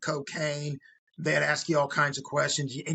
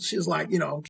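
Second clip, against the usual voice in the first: ordinary delivery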